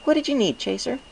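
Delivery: high, bright question; Twilight Sparkle curious. A narrator's reading. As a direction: invite him to speak. Twilight voiced.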